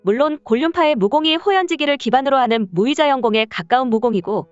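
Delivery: ordinary delivery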